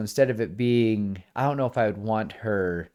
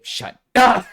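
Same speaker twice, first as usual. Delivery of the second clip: much higher, much louder outburst; yelled shut up; 13 semitones higher, 12 dB louder than usual. Retold or imitated. Coraline yelling at the teasing double, much louder and higher. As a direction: shout her down, fed up.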